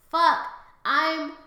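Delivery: slow, building scream to a piercingly high peak; mock horrified yell. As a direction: wail it loudly in exaggerated horror. Acts out realization when caught staring.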